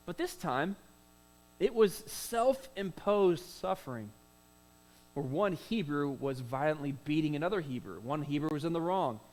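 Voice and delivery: normal talking voice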